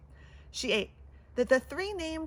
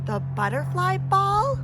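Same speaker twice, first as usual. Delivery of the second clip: excited; 7 semitones higher, 7 dB louder than usual. Acted. Annabelle thrilled by the ball.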